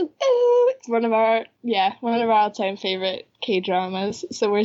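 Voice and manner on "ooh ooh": high, sustained "ooh", louder; excited "ooh". Real, not acted. Host echoing cohost's cheer at the reveal, high excited ooh. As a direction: echo a high, held ooh.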